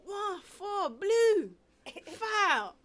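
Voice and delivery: high, loud, drawn-out foul call; referee's call. Retold or imitated. Referee calls a foul at a basketball tournament, shouted. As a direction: imitate a ref calling across the court. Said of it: "referee gotta call out the, the number".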